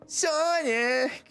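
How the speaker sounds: high, drawn-out cry of Sonic; Sonic call imitation. Mocking the friend's iconic Sonic line, high. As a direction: belt the name like the game character. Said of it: "He's like".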